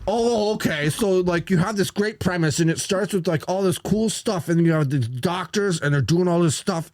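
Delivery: high nasal-free whining reviewer; mock the critic, high and whiny; whiny nerd voice. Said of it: "Weasley whiny voice doing it".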